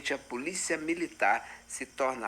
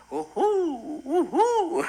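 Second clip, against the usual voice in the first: whooping laugh; 9 semitones higher, 4 dB louder, much slower than usual. Real, not acted. Cheering after battle call.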